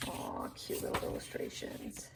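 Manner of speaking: hushed whisper of delight; soft cooing whisper; whispered aww. Real, not acted. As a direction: breathe it out softly, charmed. Cooing over cute drawings.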